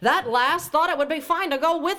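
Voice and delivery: put-on old-timey scolding voice. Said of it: "why am I putting on an old-timey voice?".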